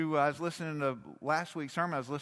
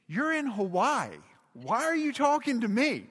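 exasperated retort; 7 semitones higher, 5 dB louder than usual. Retold or imitated. Pastor to colleague emailing from vacation, exasperated. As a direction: protest in disbelief, pitch leaping up.

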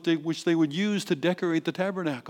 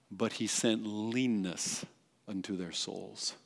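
solemn reading; 6 semitones lower, 12 dB quieter, slower than usual. Shown as a demonstration. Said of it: "one of the most fearful verses".

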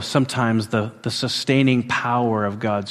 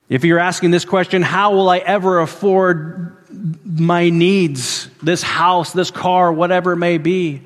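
impassioned preaching; 7 semitones higher, 5 dB louder than usual. Acted. Preacher voicing the congregation's worries.

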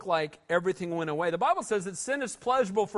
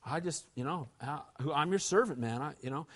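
very quiet, hesitant mumble; fumbling, hushed suck-up; mock mumbling David; 8 semitones lower, 7 dB quieter than usual. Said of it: "And here's David".

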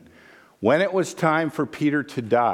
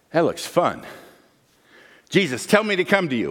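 eager Peter; 5 semitones higher, faster than usual. Acted. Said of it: "And Peter goes".

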